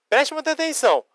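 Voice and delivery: normal talking voice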